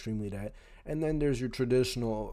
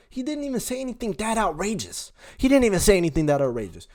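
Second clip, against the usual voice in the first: heated insistence; 8 semitones higher, 6 dB louder, faster than usual. Real, not acted. Defending him, getting heated.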